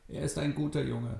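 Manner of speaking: put-on old woman Maria. Old Maria tells Nele it's true, frail old voice. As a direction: speak as a frail old grandmother.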